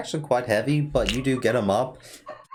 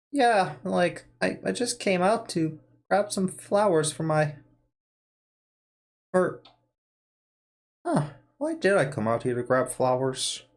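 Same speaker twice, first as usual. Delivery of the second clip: put-on old man voice; 4 semitones higher than usual. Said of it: "Old man brain".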